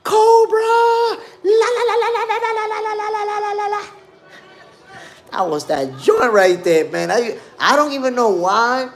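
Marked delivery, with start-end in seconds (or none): 0.0-3.8 very high, squealing cartoon war cry "Cobra!" then a chanted la la la; shrill cartoon chant, hyped and laughing; Cobra battle cry. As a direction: squeal it like the cartoon villains charging in. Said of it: "Y'all remember G I Joe?".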